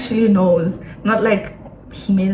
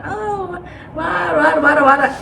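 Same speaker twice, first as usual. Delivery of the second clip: mock awe; 8 semitones higher, slower than usual. Recruiter feigns awe at her answer.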